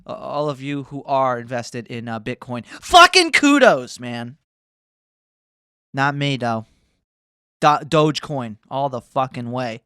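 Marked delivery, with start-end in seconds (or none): 2.6-4.0 high, loud, drawn-out cheer; exaggerated cheer. Real, not acted. Streamer cheering Bitcoin investors with a loud, slow cheer. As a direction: belt out a slow celebratory shout.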